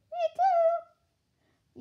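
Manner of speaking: high squeaky little voice, far above normal; squeaky kid voice. Pretend. Little monk begging to be included, squeaky high voice. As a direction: pipe up like an excited tiny monk.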